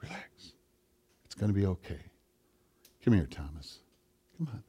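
hushed, soothing whisper; Jesus. Acted. Jesus calling shocked Thomas over, whispered and reassuring. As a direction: coax softly, almost inaudibly, pausing between phrases. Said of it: "When Jesus whispers it".

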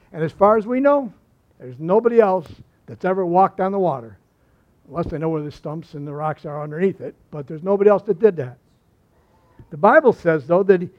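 normal talking voice

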